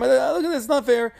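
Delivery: a wife stirring up her husband, voiced high and pushy; mock whiny wife. Korach's wife complaining to Korach.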